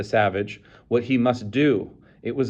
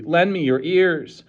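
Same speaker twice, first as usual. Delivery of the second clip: public plea; 7 semitones higher, 4 dB louder, slower than usual. A narrator's reading. Savage addresses crowd.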